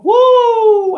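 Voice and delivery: loud, high-pitched "woo"; high whoop. Real, not acted. Whoops after his wordplay.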